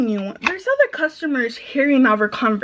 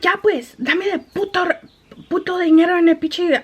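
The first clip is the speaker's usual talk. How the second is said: higher-pitched mock customer demand; irritated customer; 6 semitones higher than usual. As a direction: lightly imitate a fed-up customer, pitched up. Retold cursing customer demanding money.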